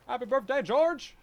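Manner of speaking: loud, high cheerful call-out; birthday shout-out. Real, not acted. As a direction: belt out the greeting.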